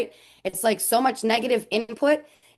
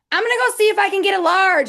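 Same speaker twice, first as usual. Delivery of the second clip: imitating mom calling out, higher and louder; 9 semitones higher, 6 dB louder than usual. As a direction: call it across the shop like an unembarrassed mom. Mom calling loudly in store.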